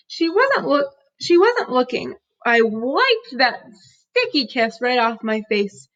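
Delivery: plain reading voice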